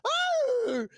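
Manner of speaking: sudden piercing high scream, like a movie extra dying; Wilhelm scream. Retold or imitated. Retelling the bear attack at the party, a hammed-up Wilhelm scream. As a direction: shriek it out like the classic film death scream. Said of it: "Wilhelm scream".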